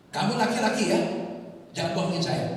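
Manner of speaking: loud, high and commanding warning; stern shout. Retold or imitated. Landlord shouting a warning at the young tenant, loud and stern.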